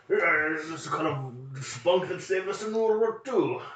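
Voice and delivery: high, drawn-out caricature of a British officer; mock British colonel. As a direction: ham it up as an old-war British colonel. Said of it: "the Colonel".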